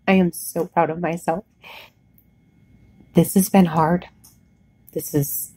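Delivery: holding back tears of pride; close to tears. Real, not acted. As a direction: voice catching with emotion, real. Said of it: "I almost want to cry right now".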